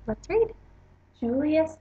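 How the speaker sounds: plain reading voice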